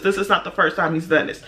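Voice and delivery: ordinary delivery, nothing marked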